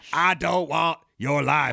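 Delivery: slow, drawn-out mock Texas drawl; bad Texas accent. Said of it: "James Van Der Beek's Texas accent".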